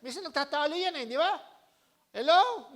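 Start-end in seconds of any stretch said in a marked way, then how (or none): 2.1-2.7 shouted hello, much louder and higher; preacher calling out to a sleepy church. Real, not acted. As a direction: rouse the listeners. Preacher's call to the crowd.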